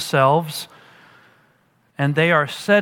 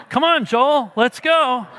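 higher-pitched urging, breaking into a laugh; calling encouragement; 7 semitones higher than usual. Retold or imitated. Father ahead calling his young son to run, encouraging. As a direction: re-enact a lively call to hurry along.

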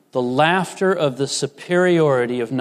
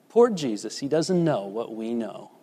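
softly mocking "poor Jesus", much quieter; mocking pity, hushed; 5 semitones lower, 7 dB quieter than usual. Preacher imitating mourners who pity Jesus, quiet and mocking. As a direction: sneer it under your breath.

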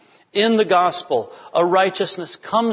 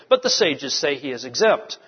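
ordinary talk

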